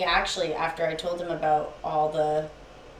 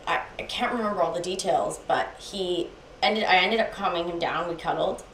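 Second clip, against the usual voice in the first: ordinary delivery, nothing marked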